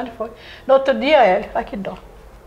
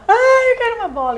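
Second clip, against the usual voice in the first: imitated crying child; 12 semitones higher than usual. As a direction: mimic a boy whining for a ball, pitched way up. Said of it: "Aí o menino chorava". (imitando menino chorão, voz bem aguda)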